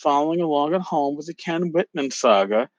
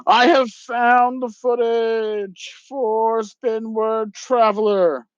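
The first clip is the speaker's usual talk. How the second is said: higher, slow, sing-song mock voice; mocking Ken's claim; 7 semitones higher, much slower than usual. Host quoting Ken's claim to have found the footage, slow mocking higher voice. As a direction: drag it out like a smug boast you don't believe. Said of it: "Ken has said".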